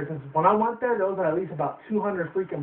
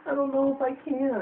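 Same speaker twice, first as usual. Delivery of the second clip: put-on small high unsure child; high timid girl voice; 9 semitones higher than usual. Girl doubting she can walk, high timid voice.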